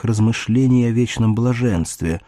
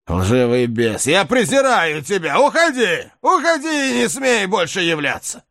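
furious, much higher shout; angry shout; 9 semitones higher than usual. Acted. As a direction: drive the demon away. Knight drives off the spirit.

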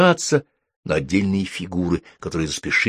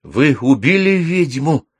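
high, loud, drawn-out question; impatient; 7 semitones higher, 5 dB louder, slower than usual. A narrator's reading. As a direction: demand an answer eagerly. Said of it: "нетерпеливо спросил Майкл". (нетерпеливый вопрос)

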